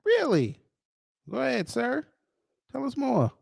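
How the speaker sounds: high, sarcastic egging on; mock eager interest. Host sarcastically urging the clip speaker on, high and mock-eager. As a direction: pitch it high, as if hanging on every word.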